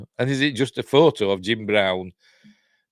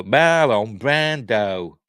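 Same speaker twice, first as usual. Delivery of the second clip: loud, very drawn-out name with exaggerated vowels; slow, hammed-up comic voice; Harry Enfield; 6 dB louder, much slower than usual. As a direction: stretch the name out slowly like a comedy catchphrase. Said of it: "Harry Enfield thing".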